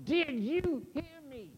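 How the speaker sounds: ordinary delivery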